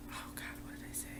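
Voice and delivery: quiet whisper to herself; whispering to herself mid-story, unsure what she said; whispered aside. Real, not acted. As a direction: whisper it, trying to recall. Trying to remember, aside.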